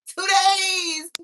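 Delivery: high, loud, drawn-out cry; mock-wailing boast. Insisting to viewers it's been sober days, mock-wailing boast. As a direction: wail it out with playful pride. Proudly insisting on short sobriety streak.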